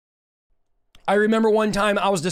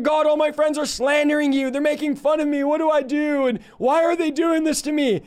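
mildly pleading, higher-pitched prayer; retold distressed plea; 5 semitones higher than usual. Preacher retelling his prayer about mocking friends, distressed. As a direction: act out a troubled complaint in a raised pitch.

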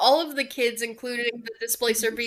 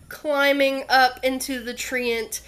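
usual speaking voice

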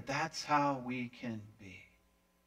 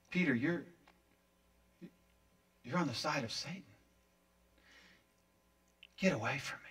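hushed rebuke; 2 semitones lower, 9 dB quieter, faster than usual. Acted. Rebuke continues after the outburst.

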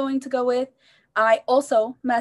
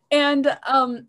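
laughing; 3 semitones higher than usual. Real, not acted. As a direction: laugh so hard it nearly turns to crying. Laughing after the retold panic.

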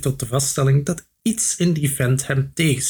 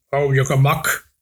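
slow, gruff warning from the innkeeper; grumbling innkeeper. A narrator's reading. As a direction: grumble it slowly, a touch of menace. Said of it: "bromde de waard".

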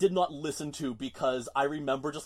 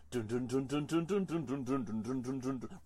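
chanted bass riff. Retold or imitated. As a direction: chant the riff like a heavy guitar. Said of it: "I could hear that bass line".

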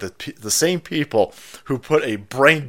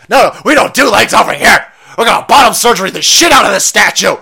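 high, loud put-on voice yelling the plan; hammed-up designer bellowing over the other; mock designer shouting; 9 semitones higher, 12 dB louder than usual. Designer shouting down his partner, high and loud.

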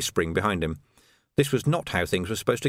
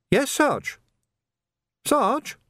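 put-on much higher, boyish recruit; an eager young constable; youthful lad's voice; young Sam; much slower than usual. A narrator's reading.

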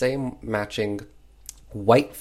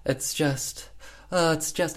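hamming it up; 4 semitones higher than usual. Retold or imitated. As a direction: overplay a tragic confession. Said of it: "definitely hamming it up".

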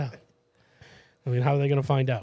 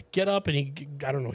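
normal talking voice

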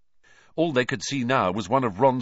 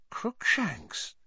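uncertain whisper; 11 semitones higher, 5 dB quieter than usual. Acted. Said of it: "Hermione whispered uncertainly".

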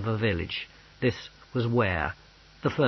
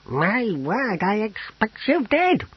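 high, slow character voice; amazed old apothecary; 10 semitones higher, slower than usual. Acted. Apothecary marvels at his visitor Pismire, amazed. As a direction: marvel slowly, pitched well up.

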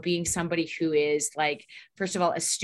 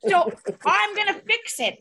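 high, loud, slow comic outburst; wife stuck with her husband, high and hammed-up; hammed-up frantic wife; 13 semitones higher, 6 dB louder, slower than usual. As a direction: play the desperate wife stuck with him. Comic exaggerated voice.

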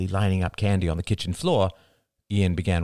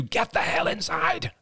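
high, raised mother's yell; understated scream, pitched up; screamed order. A narrator's reading. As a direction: yell it at kids from the front door. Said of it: "screamed at them".

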